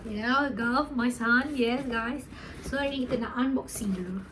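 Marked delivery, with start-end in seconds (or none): 2.6-4.2 whisper. Real, not acted. Whispered aside before unboxing.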